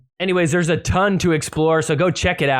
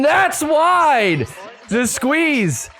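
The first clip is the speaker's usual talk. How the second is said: excited call; 7 semitones higher, slower than usual. Real, not acted. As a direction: sell the big moment. Squeeze go up twelve eleven.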